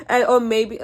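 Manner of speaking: a sudden high laugh spilling into words; giggling through the first words; high burst of laughter. Real, not acted. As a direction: crack up at your own joke. Laughing at own joke.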